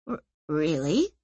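low, hesitant stammered question; falter, then lift into a hurt question; crestfallen. A narrator's reading. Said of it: "He looked crestfallen".

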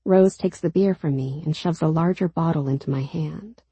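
ordinary delivery, nothing marked

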